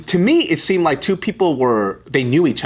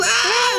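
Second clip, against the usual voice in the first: high mock wail of a hurt kid; put-on high-pitched crying; imitated child's cry. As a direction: imitate a toddler bawling after a bump. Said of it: "he hit his head on the backpack".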